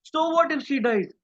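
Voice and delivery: high, dismissive "so what"; callous so what. Shown as a demonstration. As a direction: shrug her death off coldly. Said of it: "So this last question means".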